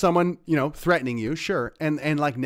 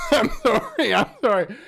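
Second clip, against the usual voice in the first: breaking into real laughter, pitched way up; laughing apology; 13 semitones higher, 4 dB louder than usual. Real, not acted. Vlogger cracking up at an insulting email, laughing apology. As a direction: giggle through the apology, can't hold it in.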